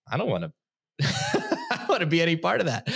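put-on high, far-off prissy girl voice with a laugh; mock the uninterested girls at events, laughing; mocking snooty girl. Mocking the San Francisco event girls, high and giggly. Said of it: "the girls ... are like".

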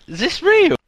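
high, loud incredulous question; disbelieving. Real, not acted. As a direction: squeal it in disbelief. Disbelief at the coincidence.

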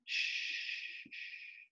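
soft whispered hushing; soothing shush. Acted. Willie Mae rocking Sistine, whispering shh. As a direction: hush the child gently.

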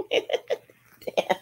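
laughing under breath; amused little laugh; breathy laugh. Real, not acted. Laughs at own reading slip.

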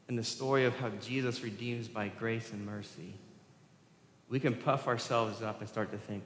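ordinary talk

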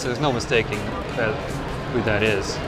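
ordinary talk, nothing marked

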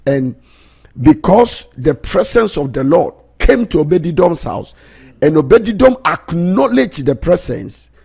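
high, loud, stretched preaching; fervent preaching. Acted. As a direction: preach it out with full fire. Preacher at full force.

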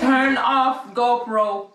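voice command. Real, not acted. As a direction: say it slowly and high for a machine.

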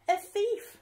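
cried out. Acted. Rose spotting the monkey thief, high alarmed cry. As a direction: shout it in alarm. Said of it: "cried Rose".

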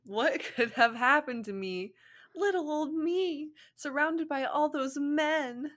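high, slow, coy damsel voice; mock-helpless Ino. Ino acts helpless to Shikamaru, coy high voice. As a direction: play up the fake helplessness.

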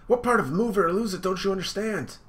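high, annoyed demand; irritated sister. Acted. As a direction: sister snapping again, high-pitched. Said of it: "sister repeats her order".

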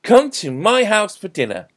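loud, high dinner invitation; calling out. A narrator's reading. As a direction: holler the invitation over the crowd. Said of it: "someone called out".

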